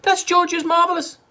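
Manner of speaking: high, loud warning; high cry. A narrator's reading. Missus Cranky warning Grandma off the medicine, high and loud. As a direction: cry it out urgently. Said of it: "cried Missus Cranky".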